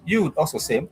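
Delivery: usual speaking voice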